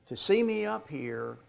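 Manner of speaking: usual speaking voice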